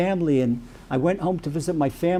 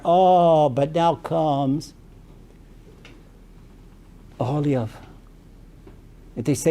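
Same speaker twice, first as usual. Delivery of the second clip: ordinary talk, nothing marked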